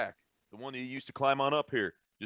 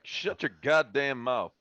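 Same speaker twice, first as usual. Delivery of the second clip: slow, louder, emphatic command; angry order; 4 semitones higher, 5 dB louder, slower than usual. Acted. Gunman silencing the man who just landed, angry order. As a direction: silence him, leaning on each word. Acted order to mouthy captive.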